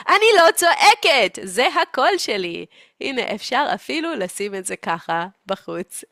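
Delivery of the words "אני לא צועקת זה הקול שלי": high, loud insistence; mock yelling denial. Mother claiming it's just her voice, mock yell. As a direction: shout that you aren't shouting. Mother denying that she yells.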